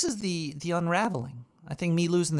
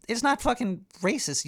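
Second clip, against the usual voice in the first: ordinary talk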